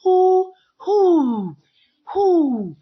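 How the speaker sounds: "who" sung out high and loud; repeating who emphatically. Shown as a demonstration. Teacher drilling "who" for young learners. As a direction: call "who" out to the class. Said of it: "So here we have".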